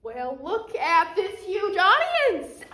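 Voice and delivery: big, high, loud stage welcome; showman's greeting. Acted. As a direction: greet the crowd like a thrilled stage magician, drawn out. Magician greets audience on stage.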